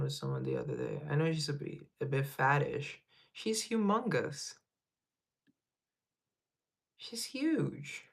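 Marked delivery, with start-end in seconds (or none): 3.3-8.0 high, drawn-out marvel at the size; playful astonishment; exaggerated amazement. Real, not acted. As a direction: stretch it out, half teasing. Exaggerating her cat's size.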